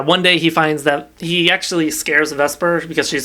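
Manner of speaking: ordinary talk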